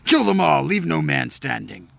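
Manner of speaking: loud, harsh battle command; ruthless order. Acted. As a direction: bark a kill order. Enemy commander orders slaughter.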